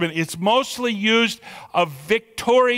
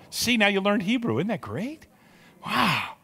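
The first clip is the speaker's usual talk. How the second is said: ordinary talk, nothing marked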